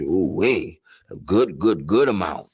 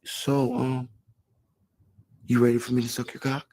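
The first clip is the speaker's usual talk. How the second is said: quoting her; 7 semitones higher, 3 dB quieter than usual. Retold or imitated. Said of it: "she's like".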